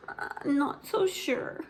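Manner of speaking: little sister eyeing her painted tail; hesitant little sister. Acted. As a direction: hold back, doubting. Ivy doubtful.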